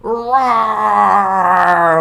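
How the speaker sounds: gentle bear growl. Acted. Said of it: "Old Bear growled".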